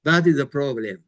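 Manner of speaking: ordinary talk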